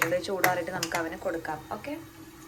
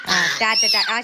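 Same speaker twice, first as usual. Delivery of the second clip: laughing bye; 3 semitones higher, 10 dB louder than usual. Real, not acted.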